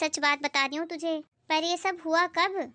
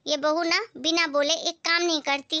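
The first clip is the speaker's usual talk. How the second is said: usual speaking voice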